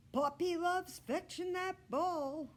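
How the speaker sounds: put-on bright boy bragging about his dog; high boy voice. Aldo telling Humphrey about Poppy, high boy voice.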